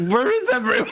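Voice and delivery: high, puzzled officer voice; baffled military leader. Retold or imitated. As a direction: play the lost commander, bewildered. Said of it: "was like".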